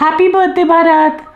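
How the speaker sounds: high put-on girl's voice; man doing a woman's voice. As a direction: pose as a girl on the phone. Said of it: "लड़के की आवाज, लड़की की आवाज में". (लड़की की आवाज़ में बोलना)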